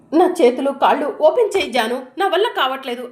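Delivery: loud, pained begging; desperate plea. Acted. Bound husband begging Janu, loud and desperate. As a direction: cry out to be untied.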